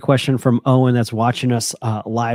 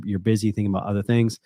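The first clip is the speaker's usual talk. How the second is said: ordinary talk, nothing marked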